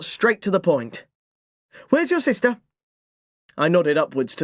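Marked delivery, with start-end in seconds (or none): 1.9-2.6 higher, sharp mum's demand; a mother wanting answers now; clipped motherly question; stern mother. A narrator's reading. Mum confronts narrator.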